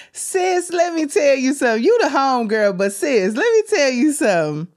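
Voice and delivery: excited sassy address; hyped-up call out. Real, not acted. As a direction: rev it up like hyping your sister. Hyping up the reviewer, sis.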